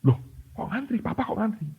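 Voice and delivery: high, puzzled question; startled to find his dad in line; high-pitched disbelief; surprised. Retold or imitated. Son sees father queuing.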